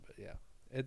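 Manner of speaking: ordinary delivery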